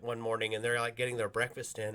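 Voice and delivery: normal talking voice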